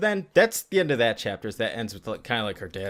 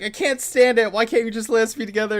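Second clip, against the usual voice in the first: higher, louder mock yell of a desperate lover; retold yelling; 8 semitones higher, 4 dB louder than usual. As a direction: cry out like a hammed-up Romeo begging to be left alone. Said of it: "it's like Romeo's yelling".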